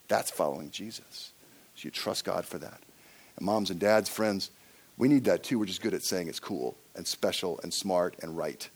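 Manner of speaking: ordinary delivery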